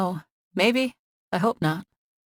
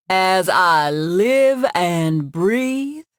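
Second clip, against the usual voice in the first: slow, drawn-out, hammed-up Southern accent, louder; exaggerated Southern accent; 5 dB louder, much slower than usual. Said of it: "in an exaggerated Southern accent".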